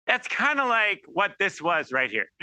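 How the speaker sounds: normal talking voice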